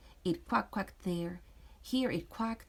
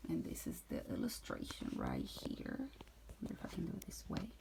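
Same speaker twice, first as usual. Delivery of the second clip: whispered teacher aside to the class; 13 semitones lower, 11 dB quieter, much faster than usual. Real, not acted. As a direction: breathe it out as a quick side note. Teacher aside while reading.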